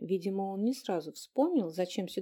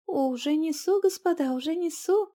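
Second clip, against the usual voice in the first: eager landlady voice; 8 semitones higher, 5 dB louder than usual. Acted. Landlady answers from kitchen.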